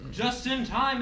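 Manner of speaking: ordinary delivery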